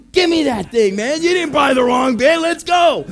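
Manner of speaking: high, loud can-do shout; rally him, brushing off the worry; excited shout. Retold or imitated. Pastor overrules the worried missionary.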